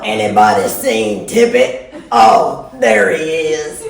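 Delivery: loud mock-surprised call; prankster aunt calling. Aunt pranking kids about her dog, loud call. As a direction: holler it like a practical joke. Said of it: "She's all".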